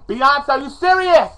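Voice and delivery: worked-up dramatic challenge; exasperated. Pretend. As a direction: demand it in agitated disbelief. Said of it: "I'm getting agitated right about now".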